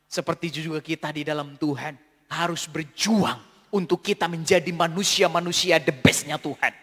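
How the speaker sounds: usual speaking voice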